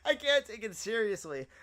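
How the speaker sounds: high, breaking up with laughter; helpless amusement. Real, not acted. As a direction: struggle to talk after a laughing fit. Cracking up at Arabic clip.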